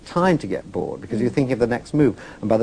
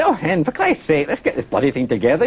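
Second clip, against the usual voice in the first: higher-pitched imitation of an exasperated Scottish producer; 5 semitones higher than usual. Producer to a German crew who speak no English, Scottish producer. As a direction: imitate a Scot chivvying his crew. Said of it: "I used to work with a Scottish producer".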